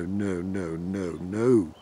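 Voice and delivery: normal talking voice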